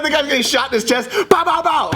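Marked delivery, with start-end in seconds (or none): 1.3-1.9 high, punchy mock gun bursts; playful vocal gunfire; imitated gunshots. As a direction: mimic rapid shots with your voice.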